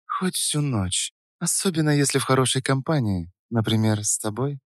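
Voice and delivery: flirtatious Саша. A narrator's reading. (игривый высокий женский голос, негромко)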